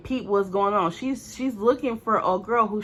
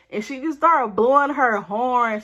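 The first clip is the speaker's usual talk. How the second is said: ordinary talk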